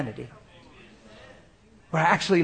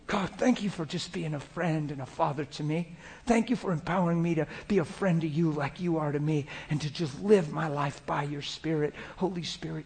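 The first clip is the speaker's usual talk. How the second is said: soft, murmured thanks to God; intimate private prayer; 2 semitones lower, 9 dB quieter than usual. Shown as a demonstration. Preacher shows himself praying while driving, soft intimate prayer. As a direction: pray very quietly, tenderly trailing down. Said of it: "I'll be driving and just talk like that".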